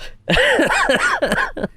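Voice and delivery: sheepish high laugh; embarrassed laugh. Real, not acted. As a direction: laugh it off after a crash. Laughs after spinning out.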